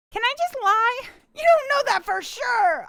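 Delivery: high, loud indignant objection; loud, high-pitched pushback; loud protest. Real, not acted. Playful protest at being contradicted.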